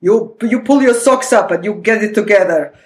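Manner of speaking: put-on stern Russian-accented command. Daughter showing parents' Russian side, strict Russian-accented order. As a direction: imitate a no-nonsense Russian parent. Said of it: "they have that Russian super".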